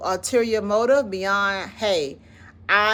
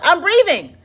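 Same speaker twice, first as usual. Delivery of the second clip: amused exclamation; 11 semitones higher, 10 dB louder than usual. Real, not acted. Caught mid-breath during demo.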